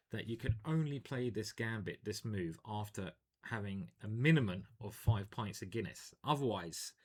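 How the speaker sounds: usual speaking voice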